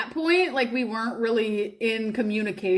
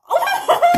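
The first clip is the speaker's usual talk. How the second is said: much higher shrieking laugh; high burst of laughter; 8 dB louder than usual. Real, not acted. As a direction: squeal of laughter. Laughing outburst.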